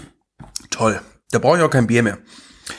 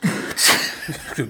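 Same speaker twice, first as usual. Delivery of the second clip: blowing nose; 5 dB quieter than usual. Real, not acted. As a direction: honk into a tissue. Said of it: "War da ein Elefant irgendwo?".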